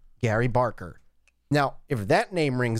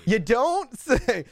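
laughing echo; 8 semitones higher, much slower than usual. Real, not acted.

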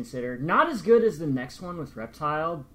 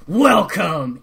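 shouted welcome; 3 semitones higher, 10 dB louder than usual. Retold or imitated.

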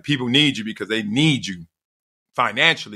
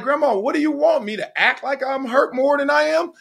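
higher, exasperated plea to grandma; getting a little mad; 13 semitones higher than usual. Retold or imitated. Grandson frustrated with grandma who keeps pushing, irritated. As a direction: push back at grandma, irritated and pitched up. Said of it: "I almost was getting a little mad".

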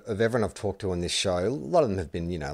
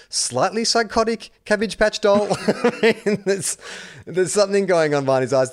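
laughing; 10 semitones higher, 4 dB louder than usual. Real, not acted. Laughing about player's look.

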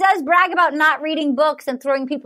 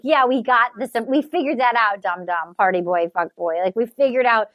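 lower, deadpan sarcasm at him; scornful retort aimed at the bachelor; sarcastic mock reply; 7 semitones lower than usual. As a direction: talk down to him like a dum-dum. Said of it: "I'm like".

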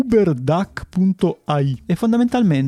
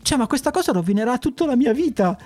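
much higher, hammed-up alarm; mock panic; 10 semitones higher than usual. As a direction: squeal it in fake panic. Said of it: "che ho visto proprio che hai detto".

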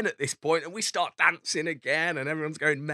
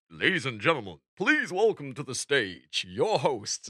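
put-on stupid American accent. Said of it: "stupid American accent".

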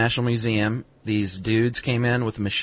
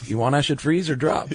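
put-on higher, confused old-timer voice; a puzzled old man asking; old guy from Raising Arizona; 5 semitones higher than usual. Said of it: "Remember the old guy?".

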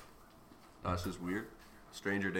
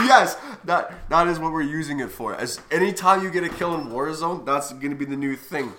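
loud, excited and fast; buzzing with excitement; 4 semitones higher, 11 dB louder than usual. Real, not acted. Excited about the clip.

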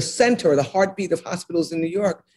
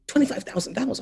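softer, savoring the amount in disbelief; hushed awe; 6 dB quieter, faster than usual. Retold or imitated. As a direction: say it quietly as if amazed. Repeating the salary in disbelief.

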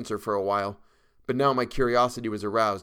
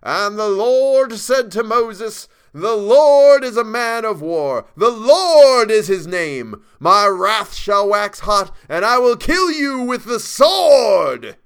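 slow, full-force high bellow; bellowing preacher; 12 semitones higher, 13 dB louder, much slower than usual. A narrator's reading. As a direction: thunder it out like scripture, high, loud and drawn out. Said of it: "when he bellowed".

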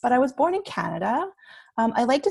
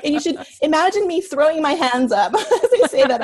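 fast, loud, laughing through it; 7 semitones higher, 8 dB louder, faster than usual. Real, not acted. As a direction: giggle through the words. Laughs about her mistakes.

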